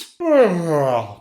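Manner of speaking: loud, heavy sigh of exhaustion; long exhausted exhale; tired sigh. Shown as a demonstration. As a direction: sigh out as if worn out. Acting tired after flight example.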